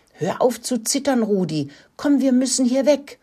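high-pitched pressing plea; urgent urging. A narrator's reading. Mouse coaxing trembling rat out of hiding, urgently. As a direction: urge a frightened friend to get moving.

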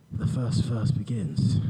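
normal talking voice

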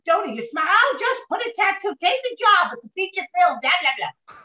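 high, eager, a touch mocking; excited agreement. Thanking the older actress, eager and high. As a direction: gush at the clever idea, high. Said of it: "I said".

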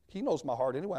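normal talking voice

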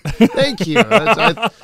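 laughing. Real, not acted. Flattered by the accent praise.

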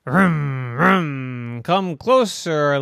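growling rum rum then a loud slow come closer; a car purring like an engine to lure her in; loud purring car coaxing her closer. Acted. Disguised wolf car lures girl.

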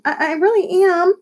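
high-pitched, pleading stammer; stammered apology. A narrator's reading. Lexi insisting she is sorry, stammering. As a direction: stumble into an earnest plea. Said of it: "stammered Lexi".

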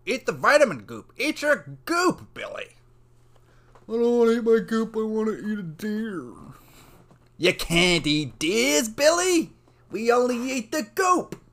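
high, loud scolding skit voices; 스킷 속 다그치는 부모와 아이. Acted. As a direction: shout it high, stretching the words, in a hammed-up skit. Improvised skit, parent scolding Billy.

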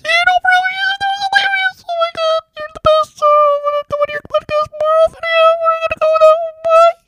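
very high squeaky comedian voice; Bert Kreischer impression. Bert Kreischer as podcast guest, very high squeaky voice. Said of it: "That's how Bert Kreischer talks".

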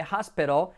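"hospital" as HAS-pero; pronunciación correcta. Shown as a demonstration. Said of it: "como una R suave española". ("hospital" como «jáspero»)

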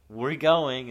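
sudden loud declaration; loud announcement. Retold or imitated. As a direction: blurt it out loud. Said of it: "it'll be like".

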